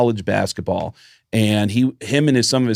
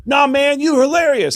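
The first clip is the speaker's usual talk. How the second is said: high, louder mock reassurance; imitating the band; 4 dB louder than usual. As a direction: voice the guys way up high and loud. Band guys reassure him, retold.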